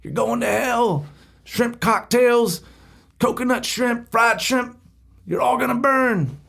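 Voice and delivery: high, drawn-out street-preacher holler; mock preacher yelling. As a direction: yell it like a crazy beach evangelist, stretching the words. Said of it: "Just sit there and just yell".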